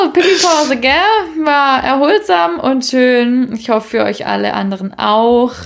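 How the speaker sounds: normal talking voice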